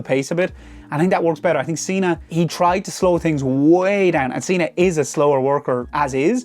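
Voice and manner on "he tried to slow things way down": drawn out slowly. Real, not acted. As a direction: stretch the words, slow and dragging. Complaining Cena slows matches.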